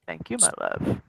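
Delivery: soft breathy thanks; tender whisper. Acted. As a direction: whisper it lovingly. Tender reply to lover.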